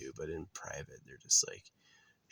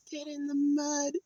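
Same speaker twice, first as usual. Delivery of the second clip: much higher, a tiny squeaky mock voice. Mocking the Mud Tank girl in a squeaky voice. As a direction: squeak it out like a cartoon character.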